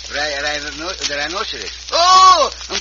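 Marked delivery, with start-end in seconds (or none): 1.9-2.5 high, loud oh of discovery; light up with an idea; sudden realisation. Acted. Pancho has a sudden idea.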